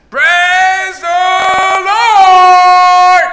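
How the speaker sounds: long, high, sung-out cry to the sky; joyful shout of praise. Acted. Old lady praising on her porch at dawn, long ringing shout. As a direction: hold the praise out like a hymn. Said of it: "raising her hands in the sky and says".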